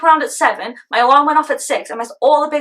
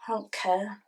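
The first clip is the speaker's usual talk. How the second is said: low, very soft sulky mumble; mumbled; 4 semitones lower, 13 dB quieter, slower than usual. Acted. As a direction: mumble it under your breath. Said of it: "Stop mumbling".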